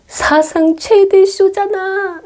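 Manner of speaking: high, slow gasp of amazement; amazed mom. Retold or imitated. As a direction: squeal it like a thrilled mother. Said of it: "감동을 한 표정으로". (감동한 엄마처럼 높고 느리게)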